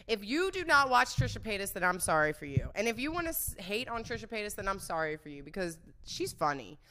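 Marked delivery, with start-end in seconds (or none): none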